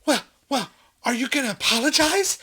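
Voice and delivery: high, loud affronted imitation; mock indignant demand. Pushed woman demanding an apology, mock outrage. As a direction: demand it, sputtering and offended.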